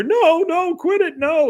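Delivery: very high, wailing protest; mock distressed cries. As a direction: imitate a helpless man pleading over the radio. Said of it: "over the radio, you hear".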